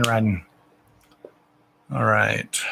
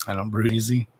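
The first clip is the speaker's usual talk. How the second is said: ordinary talk, nothing marked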